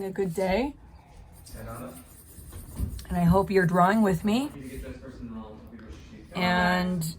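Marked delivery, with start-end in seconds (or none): none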